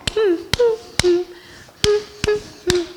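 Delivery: high hums marking the beats; hummed rhythm. Shown as a demonstration. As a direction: hum the rhythm only. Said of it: "I didn't sing the words".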